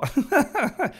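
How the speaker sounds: high-pitched incredulous laugh; burst of laughter. Real, not acted. Laughs at advice to leave. (éclat de rire aigu)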